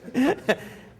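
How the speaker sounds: proud laugh. Real, not acted. Proud laugh at men's room.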